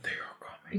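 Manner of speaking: whispered eerie omen; creepy whisper. Retold or imitated. Imitating that thing from the Harry Potter movie, creepy whisper. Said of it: "That was the creepiest thing".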